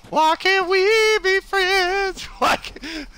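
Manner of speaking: high, slow pleading wail; whiny plea. Pretend. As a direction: plead with them, drawn out. Pleading with approaching enemies.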